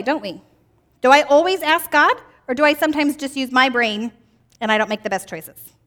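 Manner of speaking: ordinary talk, nothing marked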